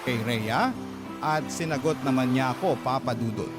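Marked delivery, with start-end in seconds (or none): none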